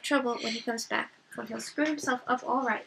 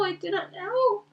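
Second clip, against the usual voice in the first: slow, higher, anguished repeat; grieving; 9 semitones higher, 8 dB louder, slower than usual. Acted. Boromir to Aragorn, head in hands, anguished repeat. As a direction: let the grief break through on the repeat. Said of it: "as if bowed with grief".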